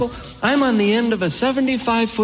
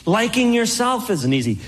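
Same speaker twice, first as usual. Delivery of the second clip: normal talking voice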